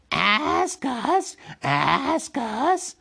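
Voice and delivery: high, insistent creature demand; Gollum's creature voice. A narrator's reading. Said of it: "said Gollum".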